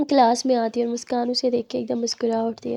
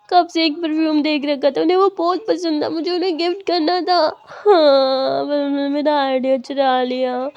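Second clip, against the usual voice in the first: higher, rising into crying; higher tearful complaint that breaks into crying; 3 semitones higher, 3 dB louder than usual. Acted. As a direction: voice breaks into sobs by the end. Child tells of stolen gift.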